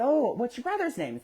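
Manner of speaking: put-on high, interested question to a toddler; talking to small child. Adult asks child about brother, child-directed voice.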